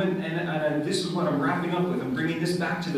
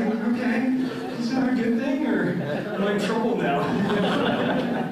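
higher, laughing mock worry; amused retold answer, higher pitch; laughing reply; 6 semitones higher, 3 dB louder than usual. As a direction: play at being nervous through a laugh. His own laughing reply.

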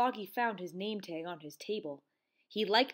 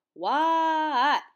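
long, high, loud holler back; yelled answer; 7 semitones higher, 10 dB louder than usual. A narrator's reading. Froggy answering friend's yell, yelled answer. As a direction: holler back, stretching the word out.

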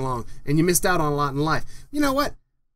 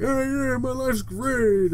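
higher, slow sing-song "my life's great"; mocking fake cheer; 6 semitones higher, much slower than usual. Ranting about a friend, mocks his fake cheer, higher and slow. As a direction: parody a friend faking that all is fine. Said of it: "act like".

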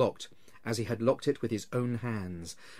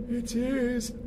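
wailing ghost; 12 semitones higher than usual. Acted. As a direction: drag each word out like a wail from the grave.

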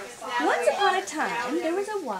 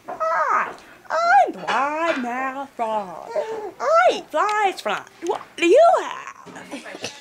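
frog voice; 2 semitones higher, 6 dB louder, slower than usual. Acted. Frog greets the alligator.

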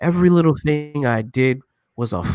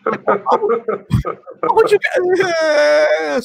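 high, slow wailing whine, louder; mock crying whine; 11 semitones higher, 4 dB louder, slower than usual. Mocking grown men who cry online, high slow whine. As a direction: blubber it out in mockery.

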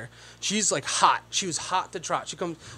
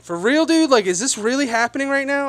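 incredulous; 6 semitones higher than usual. Retold or imitated. Incredulous at cussing in public.

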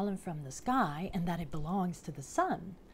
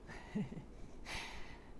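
quiet little laugh; soft chuckle; 12 dB quieter than usual. Real, not acted. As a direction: chuckle softly to yourself. Chuckles after praising the pictures.

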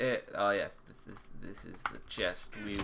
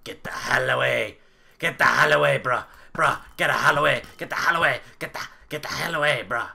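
high, very loud repeated yelling; throat-straining shout; 4 semitones higher, 12 dB louder, faster than usual. Pretend. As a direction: yell frantically to drive someone off. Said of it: "That really hurt my throat doing that".

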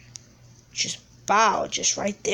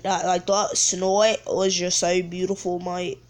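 put-on British accent; 2 semitones lower than usual. Gamer admiring own snipe, mock British accent. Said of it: "talking in a British accent".

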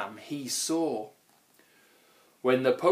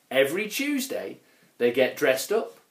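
plain reading voice

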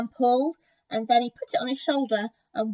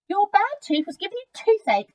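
high, loud little mouse voice; pitched-up story-book mouse; 9 semitones higher, 5 dB louder than usual. A narrator's reading. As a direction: explain it as a small mouse, pitched up. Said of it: "answered the mouse".

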